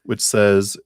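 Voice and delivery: usual speaking voice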